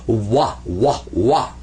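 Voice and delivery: Wah said low and drawn out like a W; slow, deliberate Wah; W sound demo. Shown as a demonstration. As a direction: repeat Wah as a W glide. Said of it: "Sometimes he sounds like a W".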